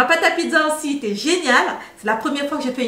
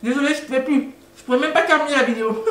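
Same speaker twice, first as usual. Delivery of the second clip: laughing with mouth full; much faster than usual. Real, not acted. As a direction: giggly, mouth still full. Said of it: "Pardonnez-moi de parler avec la bouche pleine".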